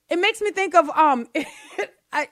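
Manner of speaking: ordinary delivery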